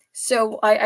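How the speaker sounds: usual speaking voice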